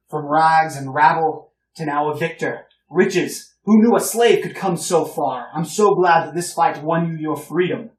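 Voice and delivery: put-on older gentleman greeting the heroes, loud and hearty; boisterous older gentleman. Old gentleman praises freed slave.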